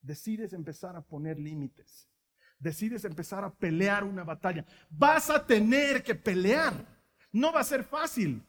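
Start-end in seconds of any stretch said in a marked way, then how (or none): 4.9-8.4 slow and loud, driving it home; a preacher pressing a hard truth; emphatic warning. Real, not acted. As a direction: hammer each word down slowly.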